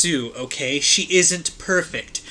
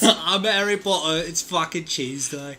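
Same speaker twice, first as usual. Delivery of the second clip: hammed-up English accent, dropping off softer; mock English accent; 3 dB quieter than usual. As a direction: do a silly English Harry Potter. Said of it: "taking issue with the sexy English accent".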